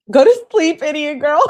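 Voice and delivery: high, loud playful shout; mock scolding yell. Reader telling off Kenzie from afar, mock yell. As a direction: yell it, on the edge of laughing.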